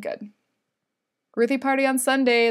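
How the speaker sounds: normal talking voice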